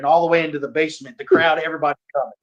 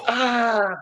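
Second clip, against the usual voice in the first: higher, imitating a sharp little ah of pain; mock pain yelp; 9 semitones higher than usual. Retelling the training chop to the host, mock yelp. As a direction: yelp like you just got chopped. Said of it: "And I'm like".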